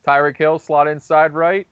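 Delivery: sportscaster calling the action; play-by-play commentary. Acted. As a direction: call the play like a broadcaster. Mock play-by-play of game.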